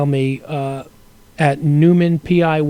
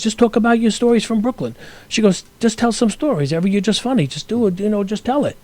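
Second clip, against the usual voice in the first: wife's voice; 6 semitones higher than usual. Retold or imitated. Wife's advice retold.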